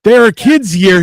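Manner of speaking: loud alarmed protest. Retold or imitated. Crowd warns the eagle handler, loud alarm. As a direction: raise your voice in alarm. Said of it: "And you're like".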